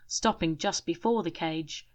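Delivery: plain reading voice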